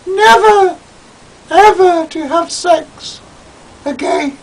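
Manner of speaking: very high, loud haughty voice; huffy Julian. Acted. Julian huffily refusing Toad, very high and haughty. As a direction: announce it with wounded dignity. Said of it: "Julian, in foul mood".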